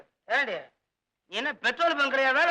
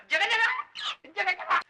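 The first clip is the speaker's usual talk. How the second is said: wailing; 4 semitones higher than usual. Acted. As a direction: howl theatrically about aches and begging for drink. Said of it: "ஏங்க இப்படி அலற". (உயர்ந்த குரலில் அலறிப் புலம்புதல்)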